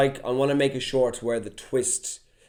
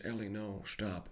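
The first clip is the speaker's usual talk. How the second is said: lower, much softer terse gruff-man voice; Joel impression; 4 semitones lower, 16 dB quieter than usual. Joel warning Ellie, in a lightly voiced low hush. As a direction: hold it back, weary and terse. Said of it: "kinda talks like this".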